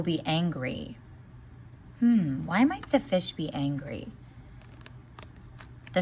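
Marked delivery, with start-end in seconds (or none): none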